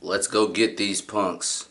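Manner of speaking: slow, low and lifeless dub line; bland dub voice. Retold or imitated. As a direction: read it slow with no energy. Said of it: "the dub version be like".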